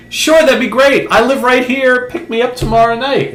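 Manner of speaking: very high, eager mock female voice; imitated woman. Attractive lady accepting Sam's dinner invitation, in imitated high voice. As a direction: put on a high-pitched lady's voice. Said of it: "and she's like".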